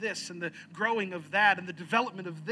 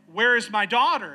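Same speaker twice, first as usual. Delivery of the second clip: loud, raised demand; screaming father; 6 semitones higher, 14 dB louder than usual. Retold or imitated. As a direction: shout it as a frantic parent. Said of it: "And he's screaming for her".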